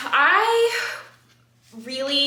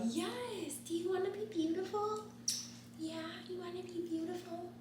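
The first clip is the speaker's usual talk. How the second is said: high, soft, breathy-sounding put-on voice; high, hushed mock sweet voice; put-on cutesy voice; 10 semitones higher, 14 dB quieter than usual. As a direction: coo it up high and hushed like a silly character. Sudden put-on voice, then apology.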